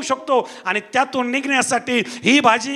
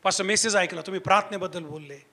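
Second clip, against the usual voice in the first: low, soft imitation of a weeping caller; subdued retelling, no real sobs; retold tearful call; 6 semitones lower, 9 dB quieter than usual. Preacher retelling a crying sister's phone call, subdued. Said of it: "रडत होती ढाळा ढाळा".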